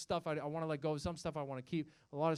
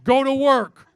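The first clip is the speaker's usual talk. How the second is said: loud, higher, barked order; gruff macho bark; 6 semitones higher, 15 dB louder, slower than usual. Retold or imitated. Manly book ordering the reader to man up, barked. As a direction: bark it like a drill sergeant. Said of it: "second page".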